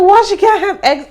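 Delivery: high, loud shocked why; shocked Zoe. Retold or imitated. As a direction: squeal the question in loud alarm. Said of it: "she was like".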